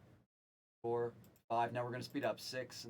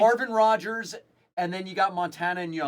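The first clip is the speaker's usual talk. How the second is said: names called out loud and high; announcing loudly; 6 semitones higher, 9 dB louder than usual. Real, not acted. Listing autographs for everybody watching, loudly announced. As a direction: project the names to the crowd.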